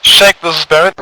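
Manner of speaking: loud radio call-out. Acted. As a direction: call the unit over the net. Leader calling on radio.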